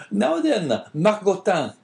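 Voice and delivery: high, loud fawning innkeeper voice; eager innkeeper. Acted. Caderousse scolds dog.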